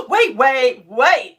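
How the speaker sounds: loud surprised gasp, then wait wait. Real, not acted. Host stunned by the viewer's drawing, loud gasping surprise. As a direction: stop everything, amazed.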